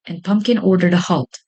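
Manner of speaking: ordinary delivery, nothing marked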